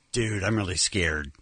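low, slowed-down dazed voice; scared stoner. Retold or imitated. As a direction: imitate a tripping guy confessing fear. Retold frightened guy on acid.

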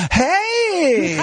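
high, drawn-out mock greeting; a long, high-pitched "you're that guy" hail; excited recognition. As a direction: imitate a thrilled fan spotting a celebrity. Retold worker recognizing a celebrity voice.

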